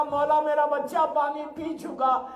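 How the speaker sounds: loud, high mother's anguished question; anguished plea. Acted. Mother asking Hussain about her baby, anguished. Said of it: "تڑپ کے کہا".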